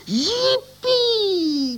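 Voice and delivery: very high squealing whoop; a toy monkey's shriek of joy. A narrator's reading. Monkey coming to life, very high excited shriek. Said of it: "kreischte der Affe".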